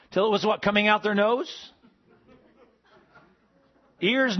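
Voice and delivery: ordinary talk, nothing marked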